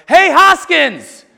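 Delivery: high, very loud drawn-out holler; full-voiced yell. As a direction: yell it like a kid calling across the bleachers. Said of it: "stands up and yells".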